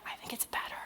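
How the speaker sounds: confiding whisper. Real, not acted. Speaker confessing a little heretical book opinion, whispered. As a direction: whisper it like a slightly heretical secret.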